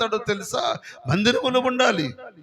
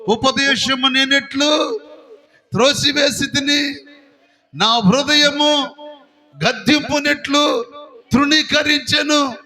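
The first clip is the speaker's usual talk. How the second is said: regretful crying out; 4 semitones higher, slower than usual. Retold or imitated. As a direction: cry out the verse like a ruined man lamenting too late. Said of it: "అప్పుడు అంటాడంట".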